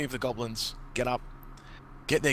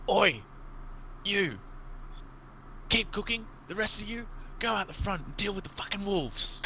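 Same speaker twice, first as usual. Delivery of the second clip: goblin boss calling out; 10 semitones higher than usual. Acted. Goblin boss shouting orders at the goblins by the fire. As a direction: yell orders across the camp. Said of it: "He calls out to three of the goblins".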